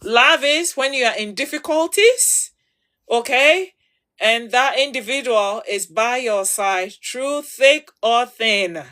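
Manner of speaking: ordinary delivery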